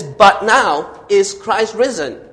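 slow, loud, drawn-out BUT now is Christ risen; "But" punched hard and high, the whole line stretched slow; emphatic but. Shown as a demonstration. Preacher booming Paul's emphatic but to the congregation. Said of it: "And Paul make it emphatically".

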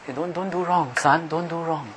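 soft, ineffectual fatherly scolding; hushed, feeble telling-off; half-hearted father. Acted. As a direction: scold your son weakly and quietly. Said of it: "and the father is".

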